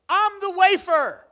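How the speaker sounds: high, loud and slow announcement; mock proclamation. Congregant imagined interrupting communion, loud mock declaration. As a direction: shout it out absurdly. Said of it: "you standing up and going".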